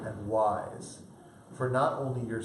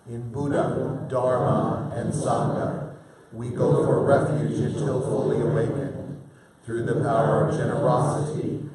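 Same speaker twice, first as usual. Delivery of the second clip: louder, steady chanted prayer; prayer recitation; 6 dB louder than usual. Acted. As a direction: recite the refuge prayer together.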